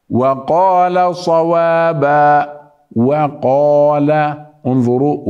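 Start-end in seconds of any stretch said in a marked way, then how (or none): 0.1-4.1 وقال slow with a thin و; واو مرققة في وقال; light waw. Shown as a demonstration. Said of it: "ليس وقال".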